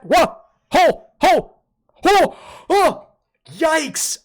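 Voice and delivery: high startled whoa; startled yelps. Real, not acted. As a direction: yelp in shock. Startled by bent hand.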